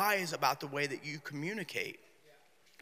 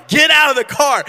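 high, loud exasperated command; out of patience with the kids, a loud high order; 10 semitones higher, 17 dB louder than usual. Retold or imitated. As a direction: snap the order out, patience gone.

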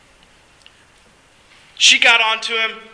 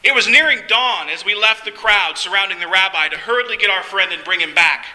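plain reading voice

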